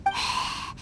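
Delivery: a soft unvoiced sigh; weary sigh. Traveler sighing at the director's pity, weary. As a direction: sigh out your disappointment. Disappointed after fruitless search.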